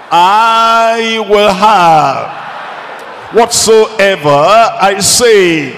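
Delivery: loud, drawn-out declaration; with conviction. Shown as a demonstration. Preacher leading congregation in a declaration of faith, with conviction. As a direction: declare it forcefully, stretching each word. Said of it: "Say it with conviction".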